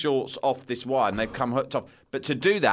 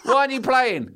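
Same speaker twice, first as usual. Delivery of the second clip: casual deflection; 11 semitones higher, 5 dB louder than usual. Retold or imitated. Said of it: "I said".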